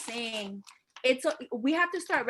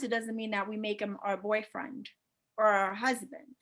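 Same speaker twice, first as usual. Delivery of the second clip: usual speaking voice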